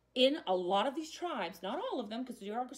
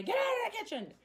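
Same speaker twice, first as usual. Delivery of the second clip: mock scream; 11 semitones higher, faster than usual. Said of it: "I'm screaming at everybody".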